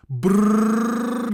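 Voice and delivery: long, loud trilled Brrr; high, exaggerated trill on Brrr; rolled R. Shown as a demonstration. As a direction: roll the r hard in Brrr. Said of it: "there's that rolling R".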